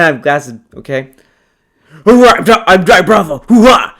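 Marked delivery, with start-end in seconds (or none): 2.0-4.0 hammed-up cartoon catchphrase voice; Johnny Bravo. Shown as a demonstration. Streamer redoing a childhood impression, hammed-up cartoon voice. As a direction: punch out each hoo-ah, pitched up. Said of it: "this was my impression of".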